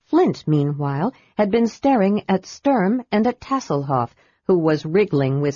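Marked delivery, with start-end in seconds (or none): none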